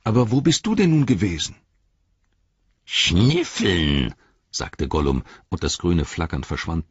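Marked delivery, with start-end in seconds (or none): 0.0-1.5 pressing, louder and quicker; lightly voiced Sam, insistent; Sam's voice. A narrator's reading. 2.8-4.1 curt sulky creature answer; a touch of Gollum's hiss. A narrator's reading.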